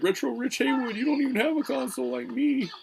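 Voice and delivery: very high, loud mocking voice; mocking DJC. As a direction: squeal it like a smug rival. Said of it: "He's like".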